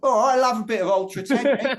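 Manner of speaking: very high, loud, quick caricature British; mock British accent. Shown as a demonstration. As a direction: ham up the British accent loudly. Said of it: "do a mock British accent as well".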